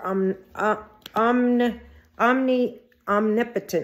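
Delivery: "omni-", restarted, then "omnipotent" completed carefully; stumbling over OMNIPOTENT. Real, not acted. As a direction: feel out the tongue twister "omnipotent" piece by piece. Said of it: "That's a tongue twister".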